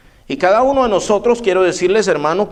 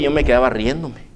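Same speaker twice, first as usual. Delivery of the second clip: usual speaking voice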